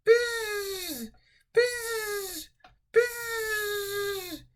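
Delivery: high buzzing bee. Pretend. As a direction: squeal buzz like a bee. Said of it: "Can you go buzz?".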